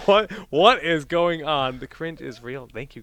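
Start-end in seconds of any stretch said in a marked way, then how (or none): 0.1-1.8 loud, slow bewildered reading; hammed-up confusion; mock confused chat. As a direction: voice the baffled chat. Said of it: "Just reading the chat".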